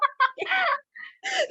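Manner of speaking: high laughing cry; laughing sob. Real, not acted. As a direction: laugh and cry at once, breaking up. Wrong ashes revealed.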